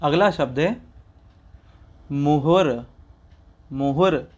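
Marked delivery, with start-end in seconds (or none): 2.1-4.3 मुहुर ending on a clear r; careful pronunciation. Shown as a demonstration. Teacher modelling मुहुर for the class. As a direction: model मुहुर with the final र sounded. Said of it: "यहाँ पे रा की रेफ है".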